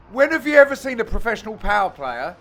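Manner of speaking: ordinary delivery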